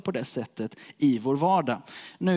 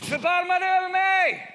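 much higher, pleading cry; raised voice; 13 semitones higher than usual. Acted. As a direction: beggar raising his voice above the shushing crowd. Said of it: "han höjer rösten ännu mer".

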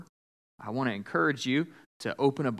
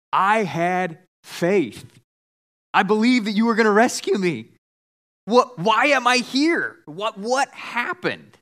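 hammed-up aggrieved questioning; indignant protest; 6 semitones higher, 5 dB louder than usual. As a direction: plead your case in disbelief. Drowned man protests to God.

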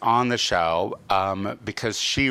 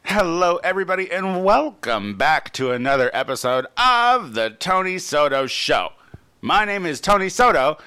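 upbeat, louder show opener; podcast host intro; 6 semitones higher, 3 dB louder than usual. Acted. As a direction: welcome the listeners like a host. Opens the podcast episode.

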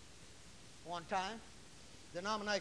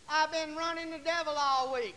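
loud, higher imitated wail; mock squalling testimony; 6 semitones higher, 8 dB louder than usual. Preacher imitating daughter testifying about the devil, squalling.

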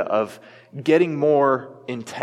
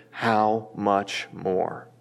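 slow emphasis; 4 semitones lower, much slower than usual. Acted. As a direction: draw it out slowly, word by word.

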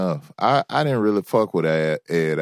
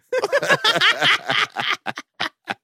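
high cackling laugh. Real, not acted. Co-host amused by own joke, high laughter. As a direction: crack up at your own joke.

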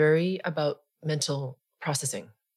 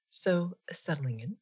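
guided meditation; 3 dB quieter than usual. Acted. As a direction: ease them gently into stillness, unhurried.

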